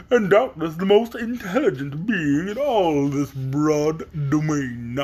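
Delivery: slow pompous big bug; pompous Wogglebug. Acted. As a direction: lecture like a vain insect. Wogglebug boasts.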